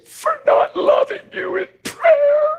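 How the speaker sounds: high, slow, crying wife voice; tearful wife. Retold or imitated. Preacher voicing his weeping wife's confession, high and slow. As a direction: let her confession break into tears.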